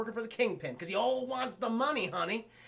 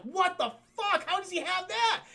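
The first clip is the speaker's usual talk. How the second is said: shocked outburst; 10 semitones higher than usual. Acted. Kingpin sees the glider attack, shocked outburst. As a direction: cry out high in mock disbelief.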